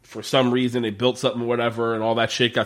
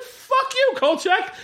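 high, squeaky indignant retort; indignant cop; slower than usual. Retold or imitated. Cop insulted by Kolchak, high, squeaky indignant retort. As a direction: squeal back in outrage. Cop's imagined reaction.

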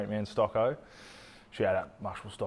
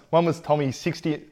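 normal talking voice

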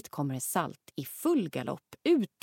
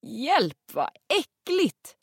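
high disgusted yelp, stretching out äckligt slowly; disgusted cry; 8 semitones higher, 6 dB louder, much slower than usual. Acted. Mother squeals at horse dung, disgusted.

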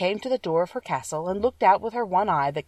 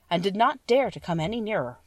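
ordinary delivery

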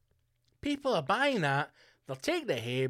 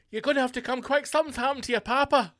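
high, urgent mock emergency call; alarmed relative; 8 semitones higher, 4 dB louder, faster than usual. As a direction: act out breaking bad news in a panic. Acts out phone call after explosion.

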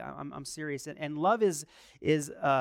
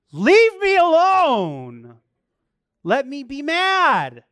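mock tantrum; 10 semitones higher, 13 dB louder, slower than usual. Mock tantrum after wife's rebuke.

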